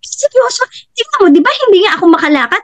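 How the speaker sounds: high, fast, excited quoting; amazed. Retold or imitated. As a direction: rattle it off in delighted disbelief. Mother marvelling she can walk.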